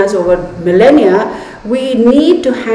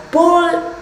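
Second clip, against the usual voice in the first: wired; 5 semitones higher, slower than usual. Real, not acted. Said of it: "okay, now I'm getting wired".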